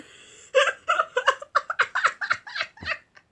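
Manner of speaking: loud, very high laughing fit; hysterical laughter. Real, not acted. Laughing at own hiccup suffering, high hysterical laughter. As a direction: laugh helplessly, long and high.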